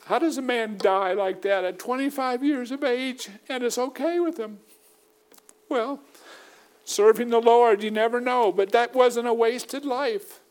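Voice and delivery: high, earnest preaching lift; high pleading preacher tone. Acted. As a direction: raise the voice in pleading sermon fervour. Preacher rising to high fervent pitch.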